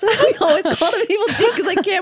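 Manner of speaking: talking through laughter, fast; laughing. Real, not acted. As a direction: giggle through the words. Laughing while explaining.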